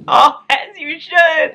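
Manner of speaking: high laughing squeal, slow and drawn out; delighted squeal. Real, not acted. Squealing with delight at a fan's gift note.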